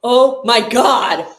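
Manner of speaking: high, loud cries at the play; overexcited yelling. Real, not acted. Fan overexcited at Pickens' play, shouting. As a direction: shout at the screen in excitement. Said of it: "I'm getting a little overexcited".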